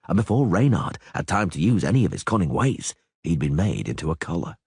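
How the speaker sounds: ordinary delivery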